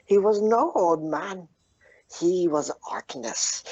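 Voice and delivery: high, emphatic insistence; dramatic reveal. Acted. Bullywug reveals the old man's identity, excited.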